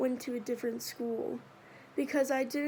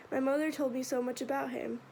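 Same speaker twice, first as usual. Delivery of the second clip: ordinary delivery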